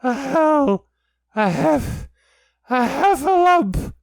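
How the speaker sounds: high, loud winded stammering; Piglet out of breath. A narrator's reading. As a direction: fight for breath between attempts, then burst it out. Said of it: "breathing so hard that he could hardly speak".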